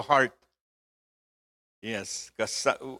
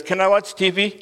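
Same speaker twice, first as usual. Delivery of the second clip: put-on pleading child; 6 semitones higher than usual. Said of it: "So he says".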